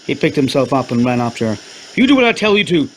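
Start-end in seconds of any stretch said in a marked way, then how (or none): 1.9-2.9 high, loud barked order. Acted. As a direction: bark the command. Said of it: "he barked".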